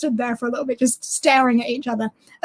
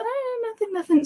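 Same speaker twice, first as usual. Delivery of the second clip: put-on innocent boyfriend; 9 semitones higher, 7 dB quieter than usual. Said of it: "And he's like".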